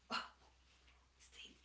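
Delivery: ordinary delivery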